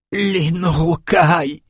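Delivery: slow, high, pained moan; moaning dying man. A narrator's reading. Dying villager moaning the enemy's name to Lila. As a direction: groan out the name in pain. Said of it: "gémit le villageois".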